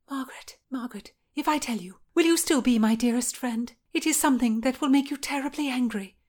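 high voice, hushed start then pleading; anxious Anne. Acted. Anne prepares Margaret for bad news, anxious.

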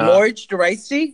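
Можно войти pitched high and slow; learner attempt. Shown as a demonstration. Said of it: "it just say".

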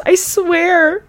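high whiny imitation; hammed-up swearing of innocence; mock desperate vow. Mimics her swearing on the call.

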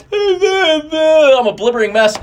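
laughing at himself. Real, not acted. A dad admitting he fell apart at the race, laughing. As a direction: laugh it out, embarrassed at crying. Laughing at own emotional state.

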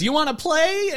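high sweet toy voice; chirpy doll line, lightly done; talking doll. Retold or imitated. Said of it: "the regular doll voice".

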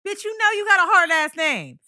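high, loud indignant rant; mock outrage. Real, not acted. Answering her cohost, loud laughing indignation.

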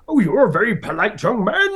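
high booming grand-actor praise; Brian Blessed praising. Retold or imitated. Actor praising a polite eight-year-old, booming. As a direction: boom a compliment down at a small boy. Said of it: "It was something like".

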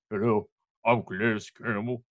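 lightly deepened mock guest voice; a terrible put-on deep voice; bad Calais impression. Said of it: "It's a terrible impression".

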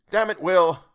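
sudden outburst to a friend; full of feeling. Acted. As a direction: blurt it out, moved and urgent. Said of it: "full of many feelings".

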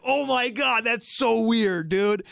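high, drawn-out mock amazement; imitating amazed brother-in-law. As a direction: play him weirded out, stretching it slowly. Brother-in-law's amazed reaction acted out.

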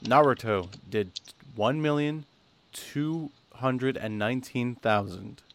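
slow ratings announcement with pauses; deliberately slow. Real, not acted. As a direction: draw out the big number, piece by piece. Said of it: "I'm gonna say this really slowly".

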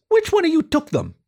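a high indignant rat demanding answers; Perry's squeaky voice, jumping up to accuse the group; agitated rat. Acted.

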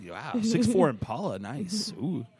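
high, loud thrilled admiring cry; excited New Mexican slang. Retold or imitated. Comic acting out seeing a lowrider, excitedly in New Mexican slang. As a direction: gush over the lowrider like a local. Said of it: "I'm like".

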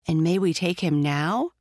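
plain reading voice

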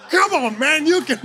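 encouraging cheer. Retold or imitated. Pastor re-enacting cheering on racers at the event, encouraging cheer. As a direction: cheer on a passer-by, half laughing. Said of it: "We were encouraging anyone".

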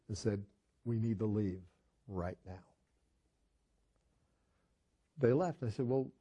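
normal talking voice

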